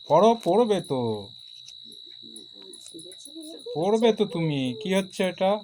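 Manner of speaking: scolding. Real, not acted. Scolding child to study.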